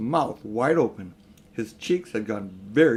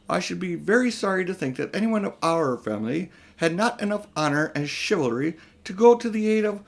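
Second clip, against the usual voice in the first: high and mighty; 6 semitones higher than usual. Acted. Uncle shaming Diggory into going, high and mighty. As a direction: preach honour down at the boy. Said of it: "in a high and mighty voice".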